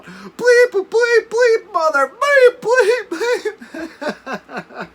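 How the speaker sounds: very high, loud string of bleeps, laughing through it; hammed-up sputtering curses from the water; mock panicked cursing. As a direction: yell the bleeps like you just fell off a cliff. Friend cursing, splashing in water.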